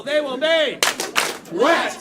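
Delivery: booming host call swerving to a punchline; host shouts a mock elimination; loud joke call. Shown as a demonstration. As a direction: shout it like an elimination, then twist it. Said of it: "Say, do that with us".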